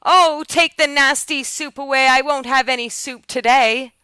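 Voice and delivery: put-on high, loud childish protest; screamed refusal. A narrator's reading. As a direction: refuse the soup at a near shout. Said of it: "he screamed out".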